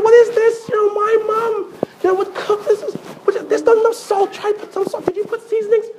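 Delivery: high, whiny voice of a picky husband; complaining husband. Retold or imitated. Uncle complains to his new wife about her cooking, high and whiny. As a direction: pitch it up and grumble about the food.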